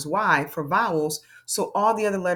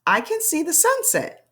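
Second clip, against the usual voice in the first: higher, lively reading; bright cheerful read; 9 semitones higher than usual. Shown as a demonstration. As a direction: read it up and happy. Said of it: "Read it one more time".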